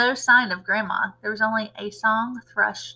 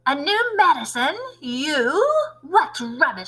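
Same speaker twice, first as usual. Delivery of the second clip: yelling; 5 semitones higher, 3 dB louder than usual. Acted. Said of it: "she yelled".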